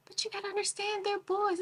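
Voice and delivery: high, soft indulgent grandmother; grandma voice. Retold or imitated. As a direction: plead for the boys gently. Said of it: "my mom is like".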